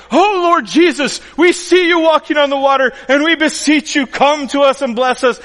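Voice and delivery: high, loud, grandly reverent prayer voice; hammed-up solemn beseeching; mock pious worshippers. Preacher imagines disciples addressing Jesus on the water, mock pious. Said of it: "instead of them going".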